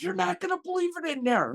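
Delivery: high, fast, excited gush; excited wife. Retold or imitated. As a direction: gush about it like a wide-eyed wife. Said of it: "and she goes".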